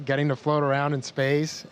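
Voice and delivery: normal talking voice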